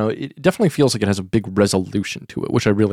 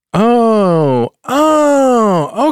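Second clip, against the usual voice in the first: surprised oh; 12 semitones higher, 7 dB louder than usual. Real, not acted. Finds the surprising search result.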